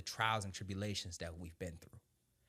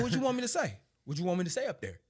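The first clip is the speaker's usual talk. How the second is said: incredulous; 8 semitones higher, 9 dB louder, faster than usual. Retold or imitated. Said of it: "I was like".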